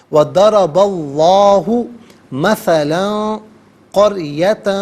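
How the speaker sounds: وَضَرَبَ اللَّهُ run together slowly, the lam of اللَّهُ heavy; joined reading. Shown as a demonstration. Teacher demonstrates joined recitation to viewers, slow and clear. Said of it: "ملا کر پڑھیں گے".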